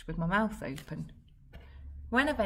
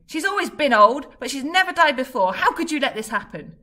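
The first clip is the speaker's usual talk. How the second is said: high, much louder, raging at him; furious yell; 8 semitones higher, 14 dB louder than usual. Acted. Fred yelling at Dad, blaming him for Grams dying. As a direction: scream the blame at him. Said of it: "I yelled at him".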